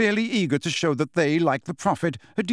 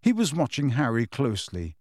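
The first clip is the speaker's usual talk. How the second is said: ordinary delivery, nothing marked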